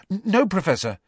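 high, stammered denial; flustered pupil, pitched high; hasty. A narrator's reading. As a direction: stumble hastily into a denial. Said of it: "said Harry hastily".

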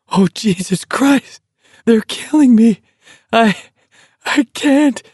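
high, halting, helpless gasps; gasping. A narrator's reading. Walker overcome by laughter, gasping that it's killing him. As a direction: gasp it in broken bursts, laughter still in it. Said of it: "Garrity gasped".

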